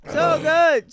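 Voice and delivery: high, loud gleeful shout; shouted delight. Real, not acted. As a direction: shout it with pure glee. Gleeful repeat of "so good.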